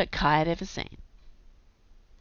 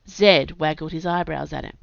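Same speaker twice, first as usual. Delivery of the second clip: ordinary delivery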